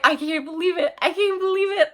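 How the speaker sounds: high, wailing disbelief; losing her mind. Retold or imitated. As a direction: wail it in a high, worked-up voice. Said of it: "I started losing my mind".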